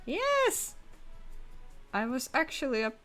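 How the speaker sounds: ordinary talk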